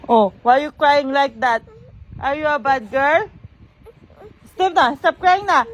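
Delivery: firm, sing-song mock telling-off. Real, not acted. Mother asks her crying baby to stop, scolding in baby talk.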